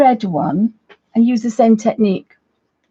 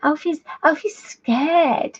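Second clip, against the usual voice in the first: much higher, slow, squeaky little voice; silly dog voice; 7 semitones higher, slower than usual. Acted. Showing viewers how Alfie really talks, squeaky and timid. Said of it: "We think he talks like this".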